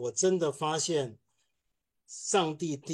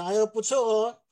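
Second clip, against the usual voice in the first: higher, teasing grudging compliment; grudging praise; 6 semitones higher, 3 dB louder than usual. Retold or imitated. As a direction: praise him half unwillingly. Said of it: "瞪了我一眼说".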